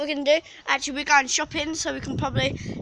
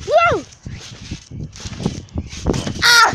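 surprised yelp; 9 semitones higher than usual. Real, not acted. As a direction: yelp as you bounce. Bouncing on trampoline.

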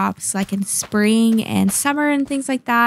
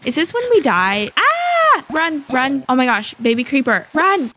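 being chased; 3 semitones higher than usual. Real, not acted. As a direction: yell while running away. Still fleeing the baby zombie.

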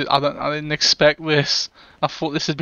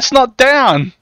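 loud high protest; urgent shout; 9 semitones higher, 8 dB louder, slower than usual. Real, not acted. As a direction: yell it over the gunfire. Arguing in firefight.

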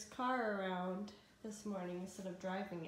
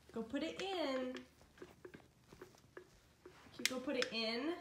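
sweet parent voice for a little one; 4 semitones higher than usual. Real, not acted. Mother coaxing her toddler.

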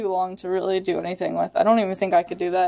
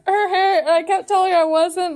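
laughing; 7 semitones higher, 4 dB louder than usual. Real, not acted. Laughing through story.

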